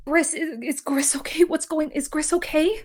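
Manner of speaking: high, urgent whisper; anxious whisper. Acted. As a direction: whisper it in worried panic. Leona anxiously asks about Gris.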